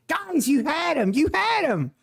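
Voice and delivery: high, wailing imitation of outrage; mock angry protest. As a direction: protest in exaggerated, high-pitched exasperation. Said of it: "He is so mad right now".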